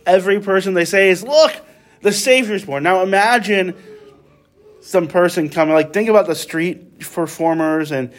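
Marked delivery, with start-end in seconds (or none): 1.2-2.8 excited announcement. Acted. Shepherds announcing to every person.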